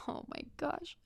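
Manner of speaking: breathy unvoiced whisper of shock. Watching a lady scream at staff, whispering in shock. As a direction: whisper it under your breath in disbelief. Shocked aside to herself in line.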